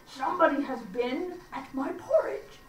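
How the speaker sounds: big bear's voice, lightly voiced; a hint of a gruff papa bear; great huge bear. A narrator's reading. Narrator reading to children, lightly voicing the great huge bear. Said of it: "in his great rough, gruff voice".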